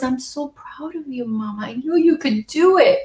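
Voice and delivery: quoting through real tears; tearful quote. Retold or imitated. Mom quotes her son's pride, crying. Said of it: "He goes".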